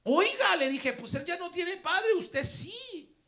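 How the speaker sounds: loud, high-pitched, driving sermon delivery; forceful preaching. Real, not acted. Preacher explaining the verse to his congregation, forceful preaching. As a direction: drive the point home to the congregation.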